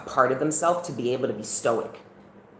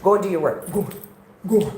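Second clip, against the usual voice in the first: loud, higher shooing call; shooing away; 7 semitones higher, 6 dB louder than usual. Real, not acted. Streamer shooing someone away off camera with a loud call. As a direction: send them off firmly.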